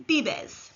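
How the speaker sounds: "bibes" with b sounds, falling; model Spanish verb. Shown as a demonstration. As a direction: say "bibes" clearly for learners. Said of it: "Repeat after me".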